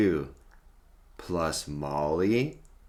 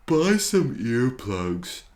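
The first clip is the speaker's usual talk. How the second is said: loud, gruff dinosaur boom; 5 semitones higher, 6 dB louder than usual. Acted. Rory brushes off his friends with a loud boom. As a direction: boom it out rudely. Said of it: "Rory booms".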